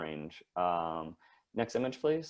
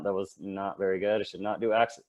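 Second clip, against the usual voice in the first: ordinary talk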